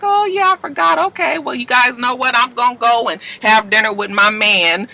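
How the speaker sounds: put-on high, breezy girlfriend voice; mock breezy Desna; breezy brush-off. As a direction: play it off lightly. Desna acting casual with friends.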